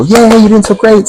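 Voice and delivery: high, loud, gushing cheer; over-excited praise. Acted. Therapist cheering a patient's success too hard, over-excited. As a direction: burst out with big mock-enthusiastic praise.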